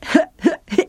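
short amused laugh; amused chuckle. A narrator's reading. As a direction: laugh at the mistake. Arad laughs at the lisp.

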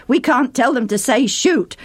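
usual speaking voice

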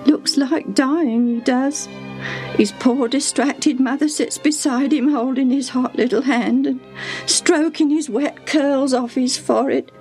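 high, plaintive, worried servant; acted tearful lament. A narrator's reading. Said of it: "she said as she mopped her tears".

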